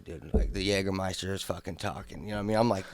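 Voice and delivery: normal talking voice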